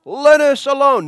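high, loud demon's cry. Retold or imitated. Unclean spirit cries out at Jesus, loud and high. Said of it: "cried out, saying".

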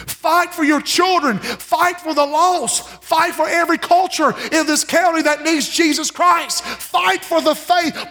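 high, slow, stretched preaching call; rally the church, drawing each word out; preaching climax. Acted. Preaching at full force.

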